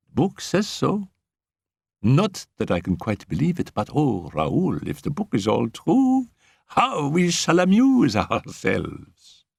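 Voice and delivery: a hint of a giggling little girl plotting; gleeful schoolgirl. A narrator's reading. Annette gleeful.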